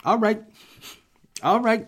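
high, loud movie-star impression; exaggerated, punchy actor imitation; Denzel Washington. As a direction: burst out like a film tough guy. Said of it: "literally like Denzel Training Day".

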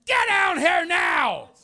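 loud, high angry shout; hollering. Retold or imitated. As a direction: bark the order down the hallway. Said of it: "So I holler".

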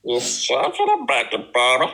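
garbled cartoon monster voice; Rick from Big Mouth. Co-host carrying on the bit, cartoon voice. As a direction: mangle it like a cartoon oddball. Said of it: "Rick from Big Mouth".

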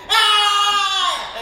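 loud, high mock cry; hammed-up toddler cry; toddler wail. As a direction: wail like a dragged child. Dragged toddler crying out.

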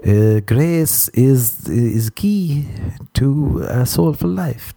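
very slow, halting Pope accent; mock-papal accent with long drawn-out words. Shown as a demonstration. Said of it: "I'll do a Pope accent".

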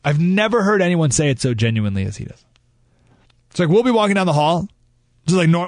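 ordinary delivery

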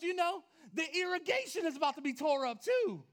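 high, shouted comic outrage; loud protest. Retold or imitated. Homeowner protesting that new grass will be torn up, shouted outrage. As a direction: wail loudly over the doomed lawn, hammed up. Acted protest about ruined lawn.

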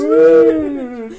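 high, loud ghostly call. Acted. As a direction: moan it like a ghost. Continuing ghost voice bit.

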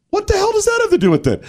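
very high, fast squeal of disbelief; incredulous. Real, not acted. Host reacting to the player's daughter argument, incredulous. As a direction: blurt it out, completely baffled.

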